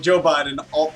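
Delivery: normal talking voice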